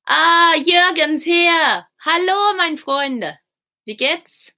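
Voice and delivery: high, drawn-out delighted welcome; excited greeting. Real, not acted. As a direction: greet an arriving friend in a slow high singsong. Greets arriving viewer Jürgen.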